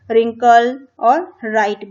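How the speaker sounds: रिंकल और राइट with no W sound, starting on R; silent W. Shown as a demonstration. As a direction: say रिंकल and राइट as rinkle and rite. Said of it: "डब्ल्यू का sound नहीं आएगा". (रिंकल और राइट में डब्ल्यू की आवाज़ नहीं)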